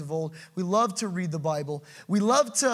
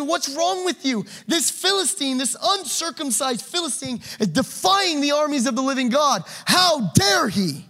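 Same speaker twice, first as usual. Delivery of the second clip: indignant David; 6 semitones higher than usual. Acted. David indignant at Philistine's taunt.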